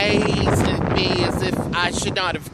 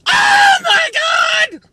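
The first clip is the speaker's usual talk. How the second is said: high, slow, loud cry of disbelief; shocked shout; 7 dB louder, much slower than usual. Real, not acted. Shocked at girl not knowing.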